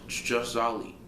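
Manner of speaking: usual speaking voice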